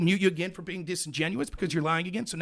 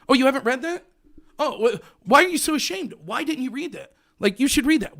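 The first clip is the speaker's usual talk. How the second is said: higher, insistent parody of an accuser; mock badgering; 5 semitones higher, 3 dB louder than usual. Host parodying an opponent demanding he read studies, badgering. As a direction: pile on mock-outraged questions, pressing harder.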